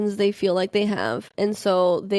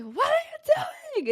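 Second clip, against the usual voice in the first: mock yell; 3 dB quieter than usual. Driver to girlfriend in back seat, high shocked shriek. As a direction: screech the question way up. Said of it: "I, like, yelled her name".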